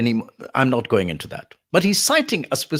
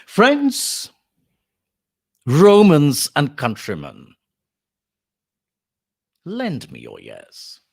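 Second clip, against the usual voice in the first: Mark Antony orating; 5 dB louder, slower than usual. Acted. Lecturer as Mark Antony opens the funeral speech, slow declaiming. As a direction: proclaim to a crowd in slow, stretched phrases. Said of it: "begins Mark Antony".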